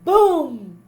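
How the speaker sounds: booming cannon sound, loud. A narrator's reading. Narrator voicing the first cannon shot, loud boom. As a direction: make it burst like a cannon.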